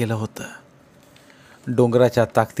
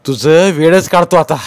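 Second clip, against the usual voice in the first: loud, high threatening shout; furious shout; 6 semitones higher, 9 dB louder than usual. Acted. Nana rushes at the madwoman shouting in fury. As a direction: roar it at her as you lunge. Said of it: "धावत ओरडला".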